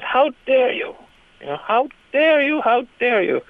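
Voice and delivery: put-on high, indignant old lady repeating herself; outraged old lady. Old lady scolding the comic, mock high outrage. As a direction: play an offended elderly woman. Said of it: "she goes".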